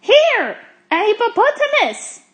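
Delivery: high, loud exclamation; thrilled shout to companions; excited discovery. A narrator's reading. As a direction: call out the sighting excitedly. Zigomar excitedly spots animals.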